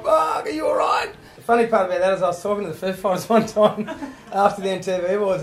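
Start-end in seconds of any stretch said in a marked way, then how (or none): 0.0-1.1 high, loud, slow alarmed yell; panicked shout. Retold or imitated. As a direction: shout it like your mate just collapsed. Bandmates panicking over fallen singer.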